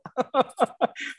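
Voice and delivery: risa alta y suave; ríe recordando la escena; risa aguda. Real, not acted. Laugh after tampoco. (risa aguda divertida)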